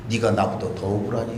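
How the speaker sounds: low, soft question from the cross; lightly voiced Jesus asking gently; gentle voice of Jesus. Jesus answers from within.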